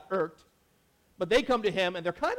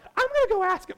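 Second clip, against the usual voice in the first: very high, louder mock mother voice; determined mom; 5 dB louder than usual. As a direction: act the eager mom set on asking. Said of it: "And the mom's like".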